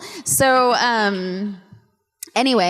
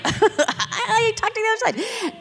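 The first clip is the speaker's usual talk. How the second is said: laughing disbelief; 14 semitones higher than usual. Retold or imitated. Retelling it through laughter.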